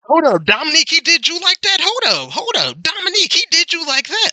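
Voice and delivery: very high-pitched, drawn-up disbelief; incredulous outburst. Real, not acted. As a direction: squeal it in playful disbelief. Mock disbelief at a player's play.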